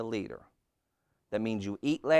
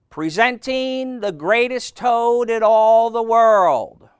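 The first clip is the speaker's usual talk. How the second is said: booming, high-pitched, drawn-out announcer; strange far-away voice; 12 semitones higher, 14 dB louder, slower than usual. A narrator's reading. Strange voice presenting Toad on stage, booming announcer. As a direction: call it out slowly to a distant crowd. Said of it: "a strange voice from far away said".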